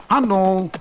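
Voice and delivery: cheerful dwarf. Acted. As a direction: greet him merrily from the rock. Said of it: "riep de dwerg vrolijk".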